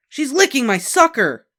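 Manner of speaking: put-on high, loud tattling boy; loud complaint. A narrator's reading. Boy tattles on the girl licking his sucker, loud. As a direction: yell it to the teacher.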